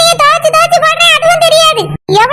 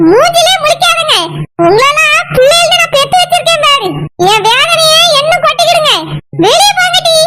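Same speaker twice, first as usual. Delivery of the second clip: ordinary talk, nothing marked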